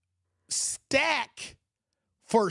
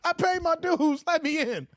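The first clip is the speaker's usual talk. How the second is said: mock drunk pleading, pitched up; drunk dude begging; 5 semitones higher than usual. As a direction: beg to be let in like a drunk guy. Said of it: "He was like this".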